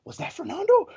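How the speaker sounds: high, giggly question; thrilled to spot someone famous; mock star-struck passenger; excited gossip. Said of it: "somebody whispering".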